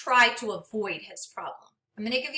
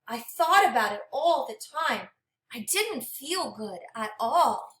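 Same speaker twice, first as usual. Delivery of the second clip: gentle, expressive storybook read-aloud; reading to children; 4 semitones higher than usual. Acted. Teacher reading a storybook to her class, expressive and gentle. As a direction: read it like a picture book for a class.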